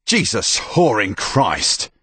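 slow, loud exasperated curse; appalled, angry outburst; angry oath. Acted. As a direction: swear in loud exasperation, drawing it out. Soldier finds girl with cut hand.

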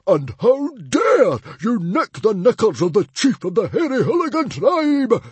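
high, loud chiefly bluster; outraged roar. Acted. Chief whose underpants were stolen, roaring. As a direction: roar with wounded dignity. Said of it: "roared Stoic the Vast".

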